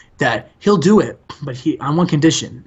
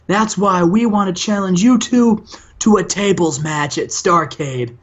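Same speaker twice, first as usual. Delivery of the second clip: much higher, hammed-up wrestling promo; over-the-top pro wrestling trash talk, pitched way up; wrestler promo voice; 6 semitones higher than usual. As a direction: bark it like a cocky tag team wrestler on the mic.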